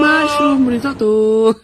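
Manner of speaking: slow, high copied call; imitating the vendor. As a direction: echo the seller's shout back. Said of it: "Listen to".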